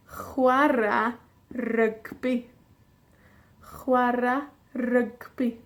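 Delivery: slow, deliberate Welsh model; slow model phrase. Shown as a demonstration. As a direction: draw out Chwarae rygbi for learners. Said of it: "teacher modelling slowly".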